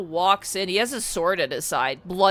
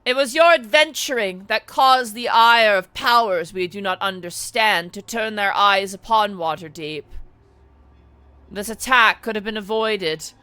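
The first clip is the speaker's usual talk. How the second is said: loud, raised accusing lord; a masked lord scolding the party; angry masked lord; 3 semitones higher, 8 dB louder than usual. Acted. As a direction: blame the adventurers sternly. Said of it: "One of the masked lords goes".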